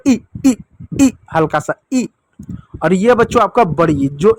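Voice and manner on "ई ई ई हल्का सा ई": teacher drilling ई; vowel demo. Shown as a demonstration. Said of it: "किस रूप में".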